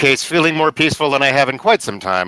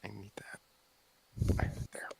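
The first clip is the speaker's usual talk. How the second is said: hushed, breathy aside; whispering; 3 semitones lower, 19 dB quieter than usual. Real, not acted. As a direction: drop to a whisper. Murmured aside at table.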